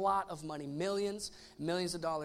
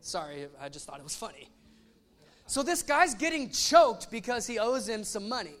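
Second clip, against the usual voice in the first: normal talking voice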